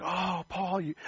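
high, breathy lament with a gasp; mock dismay. Onlookers sighing that Paul almost went free. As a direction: play the crowd sighing over a near miss.

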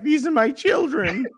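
high, slow doting pet voice; crazy cat owner. Acted. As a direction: coo it like a batty cat owner. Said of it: "like every other fucking crazy cat owner".

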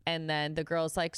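ordinary talk, nothing marked

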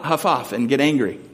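ordinary delivery

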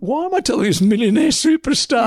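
high-pitched retold inner rant; animated mock exasperation; exasperated disbelief. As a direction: marvel at the absurdity of the job. Retold exasperated thought about pampering star.

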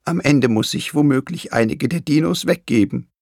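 understated storybook sob; sobbing. A narrator's reading. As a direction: voice a worried grandmother with a touch of tears. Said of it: "schluchzte sie".

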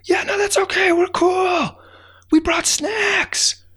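very high squeaky ant voices calling back; cheerful high ant shout; tiny ants shouting back. Acted. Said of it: "they shouted back".

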